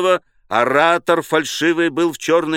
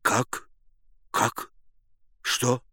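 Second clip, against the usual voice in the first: soft, puzzled questions; quiet confusion; hushed bewilderment; 6 dB quieter, much slower than usual. A narrator's reading. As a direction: murmur as if unsure you heard right. Bewildered murmurs in the crowd.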